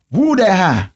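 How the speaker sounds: imitated Southern gas-station slang, run together; local Southern lingo. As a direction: mimic a Southern customer balking at the price. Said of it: "they got a lingo".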